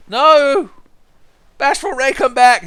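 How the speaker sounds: high, loud, dramatic calling out; desperate shout. Acted. Player calling his vanishing ally back, desperate shout. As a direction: cry after a departing comrade.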